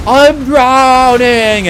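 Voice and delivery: very slow, high, loud mock cry; Samwise, melodramatic struggle. Fan mocking movie Samwise in the water, melodramatic cry. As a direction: stretch out an overwrought plea for help. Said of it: "like, struggling to".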